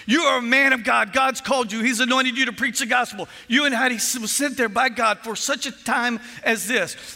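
loud, high, forceful rebuke; preacherly correction at full voice; Pastor Jacob. Retold or imitated. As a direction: fire off fierce encouragement over the phone. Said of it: "Pastor Jacob gets on the line".

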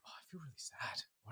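a hushed private admission; whispered. Acted. Acting out inner feeling.

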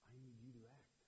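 soft, low, hushed plea; quiet plea. Shown as a demonstration. Praying teen at the end of his rope, hushed. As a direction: whisper it at the end of your rope. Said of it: "you say".

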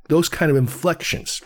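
normal talking voice

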